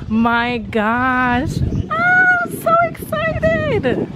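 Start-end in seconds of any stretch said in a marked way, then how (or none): 1.9-4.1 high squealing burst of excitement; a real high-pitched thrilled shriek; excited squeal. Real, not acted. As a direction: squeal it out, can't contain it. Squealing as the ride begins.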